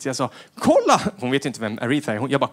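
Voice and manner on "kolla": high, loud mock call; high, loud dad calling daughter; excited call. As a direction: call her over excitedly.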